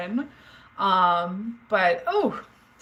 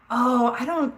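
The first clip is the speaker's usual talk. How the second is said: normal talking voice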